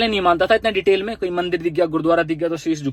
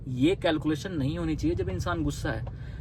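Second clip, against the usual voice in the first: usual speaking voice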